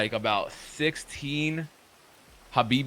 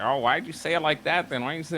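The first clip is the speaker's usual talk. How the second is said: higher, put-on whiny voice; mocking imitation; 4 semitones higher than usual. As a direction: mimic them as petty and defensive. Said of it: "They're like".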